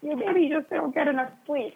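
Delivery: lightly mocking imitation; mocking the friends. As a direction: parody their easy excuse. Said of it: "and they're like".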